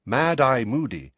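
usual speaking voice

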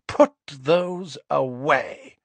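slow, icy command; slow and cold, every word a warning; cold Narcissa. A narrator's reading. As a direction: Narcissa coldly ordering the boys. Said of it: "she said coldly".

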